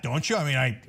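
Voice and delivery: ordinary talk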